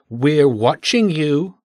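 drawn out, each word weighted; slow menacing warning. A narrator's reading. Mish warns the trapped children, slow and gloating. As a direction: lean on it like a gloating guard.

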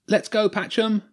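high-pitched supporter's call; high, eager sideline cheer. A narrator's reading. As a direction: call out encouragement to the team.

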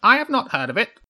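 high, quick crow councillor; crow council member. A narrator's reading. Crow addressing the chairman, high and quick. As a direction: pipe up in a high voice.

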